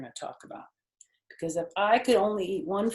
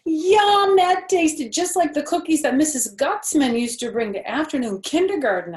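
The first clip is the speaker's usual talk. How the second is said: put-on high, loud bouncy little girl; Junie B. voice; 9 semitones higher, 10 dB louder than usual. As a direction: play a bold six-year-old showing off. Junie B. tasting cookie.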